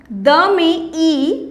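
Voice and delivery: drawn-out letter plus vowel sign; teacher sounding out each piece; slow spelled-out syllables. Shown as a demonstration. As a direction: spell it slowly, part by part. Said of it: "जैसे ये हो गया".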